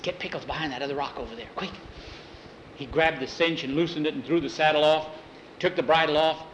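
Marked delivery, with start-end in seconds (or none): 0.0-1.7 fast, soft urgent whisper; hurried whisper. A narrator's reading. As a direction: rush it under breath. Urgent hiding.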